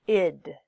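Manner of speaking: "id" isolated and loud, short i then D; id ending. Shown as a demonstration. Teacher models the third ending, "id" said loudly as a separate syllable. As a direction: punch "id" as its own syllable. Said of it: "if it's like an id".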